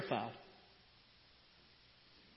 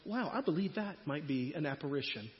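soft, detached mock-polite musing; mock calm; 4 semitones lower, 8 dB quieter than usual. Pastor mocking a calm disciple facing a ghost. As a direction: play it absurdly composed. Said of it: "you're not gonna go".

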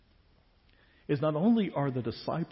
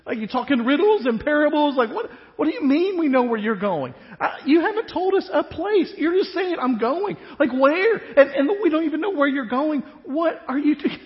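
higher and louder, voicing a baffled, frustrated Thomas; exasperated Thomas; 10 semitones higher, 7 dB louder than usual. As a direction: play a disciple at his wits' end. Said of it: "he just says what most of us would think".

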